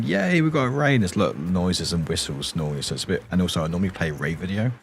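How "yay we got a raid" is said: imitating usual hype. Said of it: "I'm normally like going".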